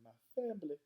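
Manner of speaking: exaggerated Southern accent. A narrator's reading. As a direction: overdo the Southern accent on "family". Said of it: "it sounded like she was saying".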